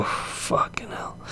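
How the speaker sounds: slow whispered dread; hushed, appalled swearing; whispered curse. Real, not acted. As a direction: breathe it out in disbelief. Dreading Bisping's trash talk.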